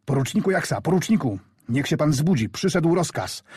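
a hint of a young soldier calling out urgently; urgent wake-up call. Orderly wakes sleeping lieutenant.

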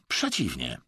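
plain reading voice

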